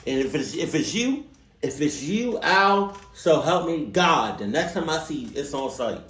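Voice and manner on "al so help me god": loud, slow "Al, so help me God"; mock threat. Real, not acted. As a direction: lay down a threat.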